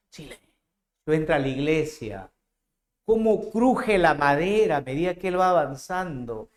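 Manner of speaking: normal talking voice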